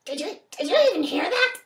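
ordinary delivery

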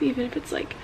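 usual speaking voice

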